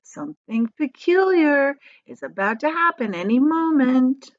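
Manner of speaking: lightly voiced boy, a touch eager; boy to himself. James sensing magic, a hint of wonder.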